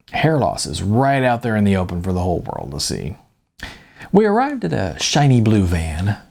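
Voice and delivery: usual speaking voice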